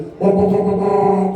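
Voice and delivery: mock air horn honking; truck horn honks. Honking like a truck for the waving kids. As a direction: honk it like a big rig horn.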